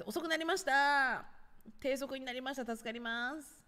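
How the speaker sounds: high, stretched-out reply to viewers; sing-song announcement. Real, not acted. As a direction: call it out high with drawn endings. Announces slowed comments to viewers. (高く伸ばしたお知らせ口調)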